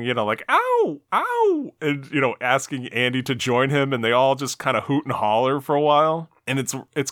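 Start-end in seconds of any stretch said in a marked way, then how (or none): 0.5-1.7 high-pitched howled "ow"; mock yell, pitched way up; Conan O'Brien. Retelling the host's hooting, high mock yell. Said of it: "Conan starts yelling".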